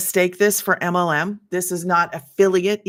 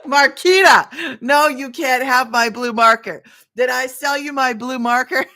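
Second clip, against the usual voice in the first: louder, playful no; teasing loud refusal; 5 semitones higher, 6 dB louder than usual. Real, not acted. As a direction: tease her back loudly.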